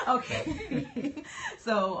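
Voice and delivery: real laugh, high and bright. Real, not acted. Guest jokes hallway not quiet.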